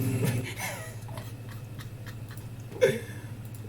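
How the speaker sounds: breathless squeaky laugh; high wheezing laugh. Real, not acted. Cracking up after the bit.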